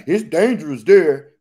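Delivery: a hint of a worried warner; mock warning. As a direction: imitate people warning you off. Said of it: "people used to be like".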